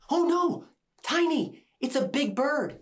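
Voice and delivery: high, panicked shout; alarmed cry. Acted. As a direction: gasp out a warning in fright. Alarm at bird grabbing Tiny.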